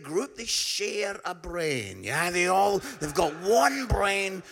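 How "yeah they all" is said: ordinary talk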